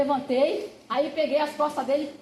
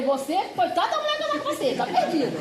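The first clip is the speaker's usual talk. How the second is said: mock pity; 6 semitones higher than usual. Mocking the rowdy friend.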